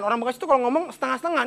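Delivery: ordinary talk, nothing marked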